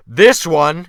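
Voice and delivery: loud jeering call; mocking shout. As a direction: shout it, pointing him out with scorn. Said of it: "Wolf Larsen shouted after".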